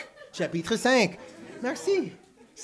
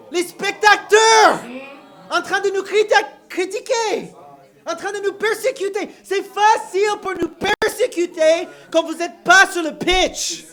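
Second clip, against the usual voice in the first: loud, high fired-up preaching; preaching at full force; 7 semitones higher, 9 dB louder than usual. Acted. Preacher at full force about persecution.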